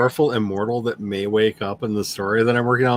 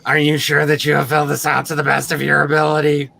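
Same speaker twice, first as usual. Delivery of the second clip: higher, louder official voice; Roz notary voice; 4 semitones higher, 4 dB louder than usual. Retold or imitated. Notary asking the one question in Roz voice. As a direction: rattle off the notary's question in Roz's voice. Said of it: "that Roz voice".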